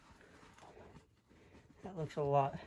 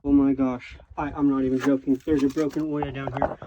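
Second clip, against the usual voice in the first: excited; 3 semitones lower, 9 dB louder than usual. Real, not acted. Finds pottery shards in cave.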